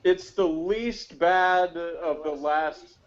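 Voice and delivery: ordinary talk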